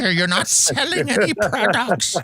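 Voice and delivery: put-on police officer voice; mock official warning voice. Mock YouTube police announcement.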